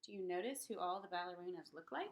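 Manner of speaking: plain reading voice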